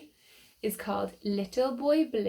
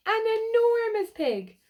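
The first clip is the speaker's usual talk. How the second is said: "An enormous pig." much higher and louder, stretched on "enormous"; excited exclaim; 13 semitones higher, 5 dB louder than usual. Real, not acted. Showing the children the huge pig, exclaiming in wonder. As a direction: marvel at "enormous".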